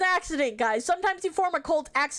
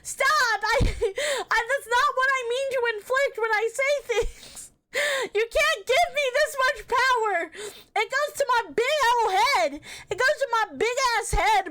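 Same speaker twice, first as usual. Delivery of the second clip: flustered mock protest; 6 semitones higher than usual. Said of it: "And I'm like".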